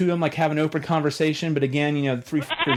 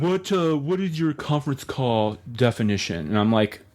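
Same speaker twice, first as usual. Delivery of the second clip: bored low operator voice; call centre operator voice; 4 semitones lower than usual. Retold or imitated. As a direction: drone like a phone operator.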